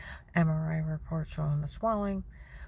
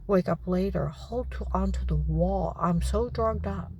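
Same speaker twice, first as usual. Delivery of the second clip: ordinary talk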